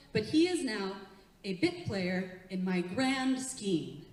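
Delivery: ordinary talk, nothing marked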